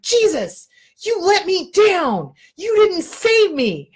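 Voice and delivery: high, loud, slow whiny complaint; 1 aggrieved complaint. Acted. Drowned man whining to Jesus at the golf course, high and loud. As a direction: wail like a betrayed man. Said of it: "he's like".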